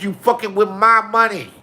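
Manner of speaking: [name] yelling at the repo men. Retold or imitated. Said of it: "He said".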